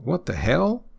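"hell" rising high at the end; stress on hell. Shown as a demonstration. Teacher repeats it for learners, rising stress on "hell". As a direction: dip on "the", then swing "hell" up. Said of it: "emphasis at the end on the word hell".

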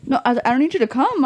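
high, stammered plea; mock panicked protest. Daughter telling dad not to come, mock panicked protest.

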